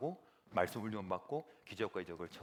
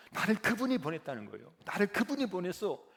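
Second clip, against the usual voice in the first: 그분이 lifted higher and louder each time, building; rising fervent cry; 8 semitones higher, 5 dB louder than usual. Shown as a demonstration. Preacher to congregation, climbing fervent proclamation. Climax of repeated line.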